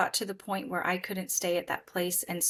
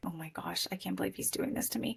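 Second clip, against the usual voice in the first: whispered aside; 9 dB quieter, faster than usual. Retold or imitated. Retold exasperated aside to herself.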